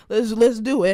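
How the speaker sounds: rally yourself, loud; hyped shout. Real, not acted. Pumped up to face the devil.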